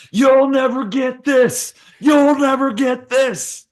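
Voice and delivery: very high, loud, slow sing-song taunt; mocking taunt. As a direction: taunt from far off, drawn out. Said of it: "You just hear him go".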